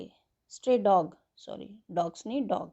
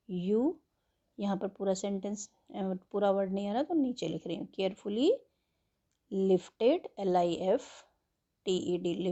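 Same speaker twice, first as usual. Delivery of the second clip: ordinary talk, nothing marked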